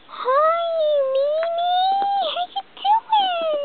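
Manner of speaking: a tearful little doll greeting her friend; high doll voice. Pretend. Doll guest greets Mimi at the party, high and tearful.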